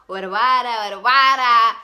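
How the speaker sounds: loud, hammed-up mock crying wail. Retelling crying at home after being stood up, loud mock wailing. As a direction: wail it out like a sulking child, half joking. I cry about it.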